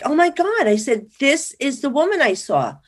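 high-pitched, excited exclamation; retold amazement at a sudden discovery; astonished recognition. Retells recognizing the picture to producer.

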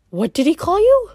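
high, sharp question; a girl's alarmed whisper-turned-question; lightly voiced worried girl; urgent girl. A narrator's reading.

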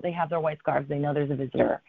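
ordinary talk

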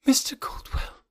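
breathless whispered question; barely able to voice it; stunned whisper. Acted. Jane stunned by the name.

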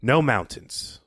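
ordinary talk, nothing marked

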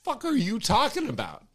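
quick, startled mock protest; baffled self. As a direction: rattle it off in disbelief. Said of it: "I'm like".

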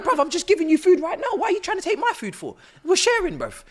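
high, exasperated "bruv, we're sharing"; exasperated retold plea. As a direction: act out pleading with a rummaging stranger. Retold plea to homeless man.

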